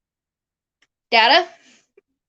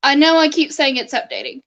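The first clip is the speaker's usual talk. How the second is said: usual speaking voice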